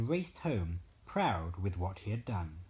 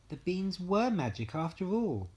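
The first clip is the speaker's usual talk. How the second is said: higher, delighted exclamation; excited cry; 8 semitones higher, 3 dB louder than usual. A narrator's reading. Jack seeing the beanstalk, crying out in wonder. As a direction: Jack thrilled, his doubt gone. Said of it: "cried Jack".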